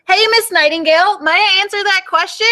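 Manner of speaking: high, loud eager schoolgirl; loud child voice. Acted. Ruby loudly asks her teacher in class, high and loud. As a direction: shout the question up to the teacher. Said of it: "head-splittingly loud".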